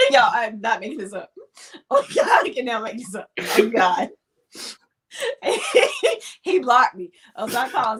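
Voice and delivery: laughing so hard it hurts; laughing through pain. Real, not acted. Laughing while retelling bad date.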